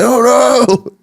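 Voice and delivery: high-pitched comic wail of alarm; mock panic. Imitating kids in the basement engulfed by flames, mock-panicked wail. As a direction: cry out in exaggerated dread. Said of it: "being like".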